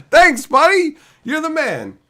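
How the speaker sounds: loud, high burst of thanks to a donor; shouted thanks. Real, not acted. Streamer thanks a donor for gas money, loud and high. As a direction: yell it out like a happy fan.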